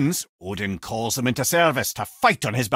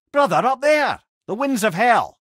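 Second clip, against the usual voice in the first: high, loud urgent warning; alarmed callout; 9 semitones higher, 5 dB louder than usual. Acted. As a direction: point out the danger overhead. Alarmed callout during fight.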